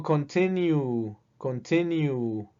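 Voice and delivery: "continue" spoken slowly, syllable by syllable; "continue" drawn out to show its final u sound; slow pronunciation demo. Shown as a demonstration. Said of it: "Termina con el sonido de vocal".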